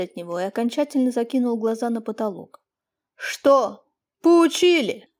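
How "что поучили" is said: higher, louder, a shaky catch in the voice; father, shaken, asks his son if he learned; trembling voice. Acted. Father asks the beaten son, voice trembling. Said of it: "несколько дрожащим голосом".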